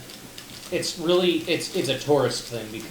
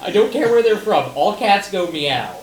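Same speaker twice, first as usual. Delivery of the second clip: louder and higher, indignant protest; retelling an argument with a friend, raised and indignant; exasperated rant; 6 semitones higher, 5 dB louder than usual. Retold or imitated. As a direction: argue it with mounting disbelief. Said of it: "I was like".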